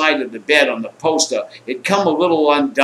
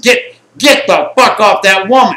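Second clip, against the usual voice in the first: acted shouted order; 5 semitones higher, 5 dB louder than usual. Acted order through the window.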